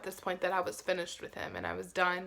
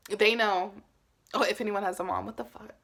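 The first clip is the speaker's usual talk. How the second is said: ordinary talk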